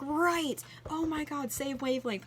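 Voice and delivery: high, loud gushing agreement; excited agreement. Retold or imitated. Cast member eagerly agreeing with her complaint, high and excited. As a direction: gush back in total agreement.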